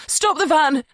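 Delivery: loud, high-pitched panicked cry; sudden cry. A narrator's reading. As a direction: cry out suddenly to halt everything. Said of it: "she cries out suddenly, her scream".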